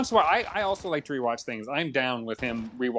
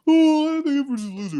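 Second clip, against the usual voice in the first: a much higher, put-on voice that opens loud; Boo's voice; 12 semitones higher, 4 dB louder than usual. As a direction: become Boo and pass a cheeky verdict. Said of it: "what would Boo say?".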